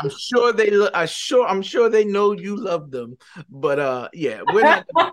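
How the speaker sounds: high, loud excuse to God; reluctant protest. Retold or imitated. Man making excuses to God about the couple, reluctant protest.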